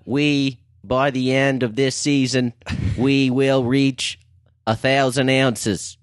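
put-on slow Boston accent, JFK style; drawn-out Boston accent speech. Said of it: "This is a Jack Kennedy accent".

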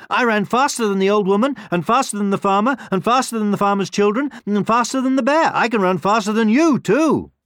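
high, quick bragging list; proud little character, loud and high; boastful gingerbread man. A narrator's reading. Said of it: "said the gingerbread man".

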